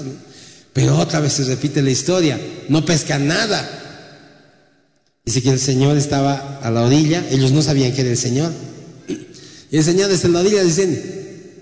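normal talking voice

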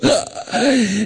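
sharp acted gasp of fright; frightened mock gasp. Imitating thief nearly fainting from fright, mock gasp. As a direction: suck in air as if startled.

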